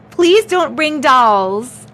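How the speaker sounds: pleading. Real, not acted. Pleading, loud and slow.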